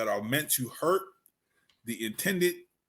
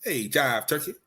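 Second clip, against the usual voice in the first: lightly played seventies jive talker; jive-era slang voice; 3 semitones higher than usual. Co-host playing someone from the seventies, mild jive voice. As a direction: put on a touch of old-school jive. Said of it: "those in the Jive Turkey era".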